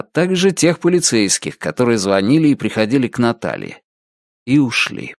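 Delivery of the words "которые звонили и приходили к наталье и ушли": ordinary delivery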